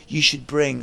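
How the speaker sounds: put-on a light London accent; hint of a London accent; London accent impression. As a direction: lightly imitate a London lad. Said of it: "I won't do his accent anymore".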